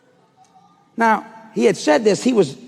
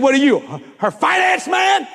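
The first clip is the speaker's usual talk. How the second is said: mocking sneer; 8 semitones higher than usual. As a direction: drag out man in a high mocking voice. Salesman mocking him, retold.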